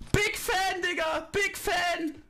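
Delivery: excited shouting. Real, not acted. Hyped shouting.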